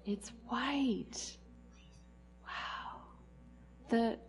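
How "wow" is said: breathy whispered wonder; whispered wow. Acted.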